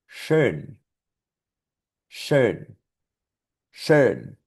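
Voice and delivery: "Schön" with sh and rounded ö; German schön. Shown as a demonstration. Teacher models the German word, careful pronunciation. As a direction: say "Schön" slowly with sh. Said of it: "otra palabra con esa combinación".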